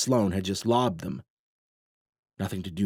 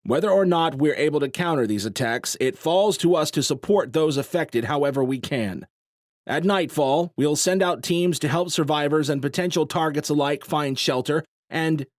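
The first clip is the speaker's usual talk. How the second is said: serious commander; 6 semitones higher, 4 dB louder than usual. A narrator's reading. Nelkin addressing the crowd.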